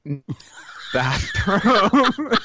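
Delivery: laughing through a sheepish answer; laughing reply. Retold or imitated. Answering the watch, laughing. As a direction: say it while cracking up. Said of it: "And I'm like".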